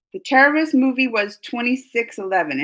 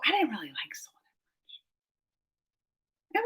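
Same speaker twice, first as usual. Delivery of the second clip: whisper; 12 dB quieter, faster than usual. Real, not acted. Confessing a dislike.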